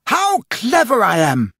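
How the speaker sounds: put-on gleeful boy bragging at the top of his voice; crowing rapturously. A narrator's reading. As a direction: crow it out like a cocky boy. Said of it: "he crowed rapturously".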